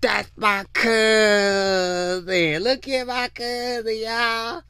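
high, slow, drawn-out bragging cousin; mock proud cousin. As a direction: show off the cousin to everyone, high and stretched. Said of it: "be like".

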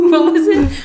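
laughing. Real, not acted. Still laughing, loud.

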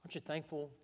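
ordinary talk, nothing marked

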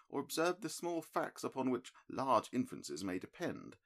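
measured, mildly disappointed sleuth; a hint of a cool analytical detective; Holmes, lightly voiced. A narrator's reading. Holmes explaining reasoning.

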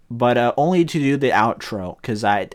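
normal talking voice

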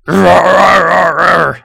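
loud roaring troll. Acted. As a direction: roar it out like a beast. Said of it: "gets up and is like".

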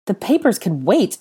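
indignant, questioning repeat; incredulous echo. A narrator's reading. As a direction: throw it back in outraged disbelief. Outraged echo of Harry's words.

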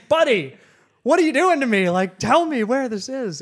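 loud, high, indignant appeal; acted-out frustrated outburst; exasperated plea. Acted exasperation at unhelpful staff.

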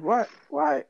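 high teasing Jamaican voice; mock Jamaican friend roasting; Jamaican accent. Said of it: "They used to roast the shit out of me".

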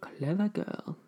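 hushed, breathy whisper of a movie line; whispered film quote. Retold or imitated. Quoting Jurassic Park to the co-host, whispered.

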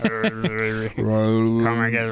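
slow, low voice through a fan. Acted. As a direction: growl it into the fan blades. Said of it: "sound like Darth Vader speaking into the fan".

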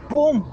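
sharp high "pum" like an impact; imitated bang. Narrator imitating the crash on the road, sharp high bang.

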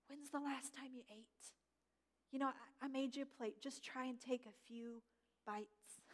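hushed, caring voice of a friend; gentle whisper. Retold or imitated. Friend urging a grieving person to eat, gentle near-whisper. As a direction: speak softly, as if close by a grieving friend's ear. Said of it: "and just whispers".

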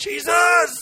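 very high, drawn-out cry; high-pitched imitated shout; desperate shouting out. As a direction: cry out like a beggar calling for rescue. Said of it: "Bart shouting out".

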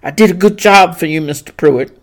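fast, eager to please; seeking approval. Acted. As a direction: fish for praise. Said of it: "obviously seeking approval".

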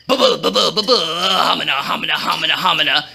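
low, babbling stutter; dumbstruck stammer. A narrator's reading. Stunned Haman answering the king, low stammering babble. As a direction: stumble on syllables, then burble low. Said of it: "Haman stammered".

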